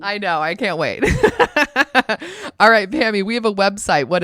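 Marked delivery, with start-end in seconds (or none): 1.1-2.5 high giggle. Real, not acted. Laughing at joke.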